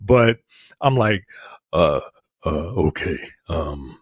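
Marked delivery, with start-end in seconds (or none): 1.7-4.0 re-enacted nervous, halting start on stage; lowered voice. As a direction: pitch it low and hesitate between words. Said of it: "I'm trying to l-, like, lower my voice".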